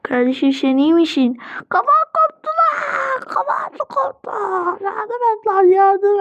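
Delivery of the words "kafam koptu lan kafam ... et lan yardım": high wailing mock cries for help; play-acted panic. Injured child begging for help, high panicked wail. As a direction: wail in high, exaggerated agony.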